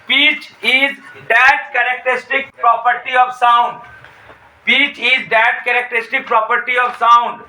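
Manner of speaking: very slow dictation, word by word with long pauses. Shown as a demonstration. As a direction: spell it out slowly so students can write. Teacher dictates definition slowly.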